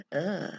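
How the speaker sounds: gasp, then a low groaned ugh; mock dismayed gasp. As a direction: act out a parent's dismayed reaction. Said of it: "and you go".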